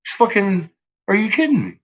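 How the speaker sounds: quoting himself in his own video, stunned; incredulous retold question. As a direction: fire it off, baffled and fed up. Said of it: "I said".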